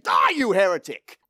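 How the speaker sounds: sudden hammed-up condemnation; mock outraged yell. Storyteller as the man who pushed the heretic, mock outraged yell. Punchline, pushing him off bridge.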